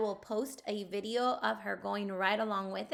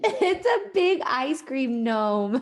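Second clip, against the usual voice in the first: laughing; 3 semitones higher, 6 dB louder, much slower than usual. Real, not acted. Mother laughing at picture.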